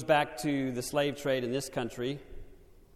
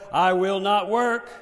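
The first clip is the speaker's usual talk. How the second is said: ordinary talk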